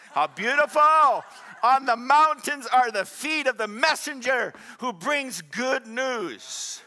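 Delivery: loud, high declamation of a verse; ringing scripture recital, stretched at the end; preaching scripture. Real, not acted. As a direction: proclaim the verse to the whole congregation.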